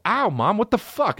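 high, pained whine at mom; mock protest. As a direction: yelp a complaint at mom. Acts out his hurt protest to mom.

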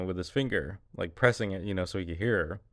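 ordinary talk, nothing marked

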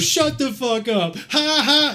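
high mock outburst breaking into a cackle; imitated crazy laughing. Crazy train rider mocking a stranger, high imitated laughter. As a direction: play the crazy heckler, pitch way up, then laugh. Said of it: "he's, like, really laughing, like, loud".

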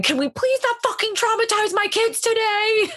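high, exasperated retold yell. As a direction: fire it off high and fed up. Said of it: "I think I yelled something".

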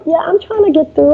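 a nervous caller who doubts herself; wimpy caller. Retold or imitated. Cold-calling a gatekeeper, sounding wimpy. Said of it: "sounding wimpy on the first ten or so calls".

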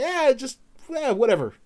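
high, tossed-off "whatever"; dismissive brush-off. Retold or imitated. Retold brush-off to teammate.

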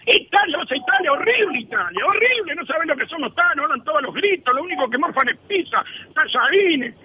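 high, disgusted rant about Italy; ranting barber. Acted. Barber trashing Italy to the client, high and disgusted.

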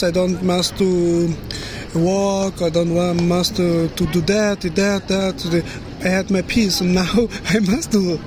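normal talking voice

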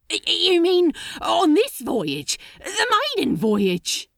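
appalled high-pitched question; horrified disbelief. Acted. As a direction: stutter it out high and loud in shock. Crowley horrified at the plan.

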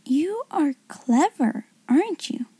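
hushed admiration, lifting in pitch; touch of awe. A narrator's reading. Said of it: "with a touch of awe in her voice".

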